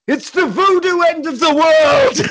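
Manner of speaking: high, loud hammed-up doom; mock doom proclamation. Old man warning the heroes, hammed-up doom. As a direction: proclaim the apocalypse with mock gravity. Dramatic doom line in retelling.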